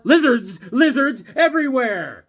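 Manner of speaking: loud, high alarmed cry; excited shout. Pretend. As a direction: yell it like a panicked comic cover. Mock cover blurb, shouted.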